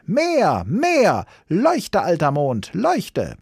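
put-on very high child-character cries; piercing, insistent yelling; screamed demand. A narrator's reading. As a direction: call out each demand like an insatiable little boy. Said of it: "schrie Häwelmann".

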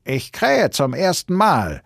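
high, slow, loud rooster voice; drawn-out rooster announcement; calling down. A narrator's reading. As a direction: crow it down from the tower. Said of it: "rief der goldene Hahn herunter".

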